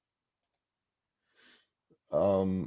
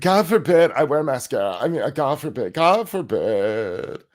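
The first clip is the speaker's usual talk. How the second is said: mocking whiny soldier; 5 semitones higher than usual. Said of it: "bitching because they can't wear mascara".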